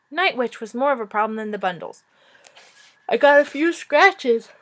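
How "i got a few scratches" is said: higher, louder, slow yawned words; through a yawn. Real, not acted. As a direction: yawn your way through the line. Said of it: "It is ridiculous that I'm yawning".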